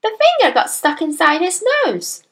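put-on high childlike reply; little girl storyteller, artful. A narrator's reading. Daughter answering her alarmed father, little girl voice. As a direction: deliver the news in a child's high voice.

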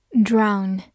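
normal talking voice

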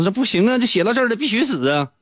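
high, insistent mock whine; stubborn Flaubert. Flaubert insisting to his friend that she must die. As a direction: refuse flatly.

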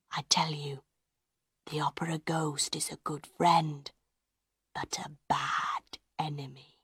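breathy ominous whisper. Acted. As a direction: whisper the threat. Giry's secret warning.

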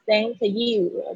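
slow "thank you" run together; linked thank you. Shown as a demonstration. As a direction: link "thank" into "you" smoothly. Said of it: "这边可以连在一起". (把"thank you"慢慢连在一起念)

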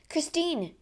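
high-pitched call of her name; crying out. A narrator's reading. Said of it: "cried the Count".

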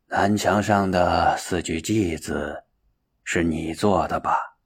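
low, soft, slow elder monk; old master, composed. A narrator's reading. Master Hongren questioning his disciple, slow and composed.

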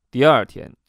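ordinary delivery, nothing marked

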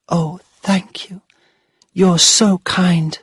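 put-on high, slow whisper of a small girl; a child's grateful hush; whispering little girl. Little girl whispers her thanks.

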